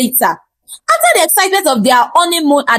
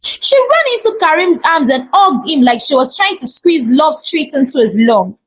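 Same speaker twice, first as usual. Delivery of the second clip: ordinary delivery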